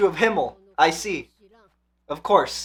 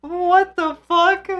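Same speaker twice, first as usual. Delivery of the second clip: stunned; 10 semitones higher, slower than usual. Real, not acted. Reacting to a shocking scene.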